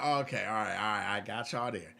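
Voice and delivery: low, retold acceptance; grudging concession. Retelling his grudging concession.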